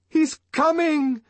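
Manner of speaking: high, drawn-out cry; excited shout. A narrator's reading. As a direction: call it out in high rapture. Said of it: "shouted Sarojini Engels".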